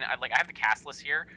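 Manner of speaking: usual speaking voice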